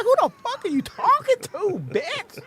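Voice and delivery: high squeaky retort; mock high yell. Man answering his passenger, high mock yell. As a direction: snap back in a high voice. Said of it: "I'm like".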